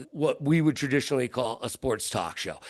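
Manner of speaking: ordinary talk, nothing marked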